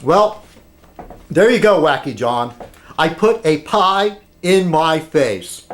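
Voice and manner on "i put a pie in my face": slow, measured statement; deliberate declaration. Acted. Telling Wacky John the dare is done, slow and deliberate. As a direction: spell out the deed phrase by phrase.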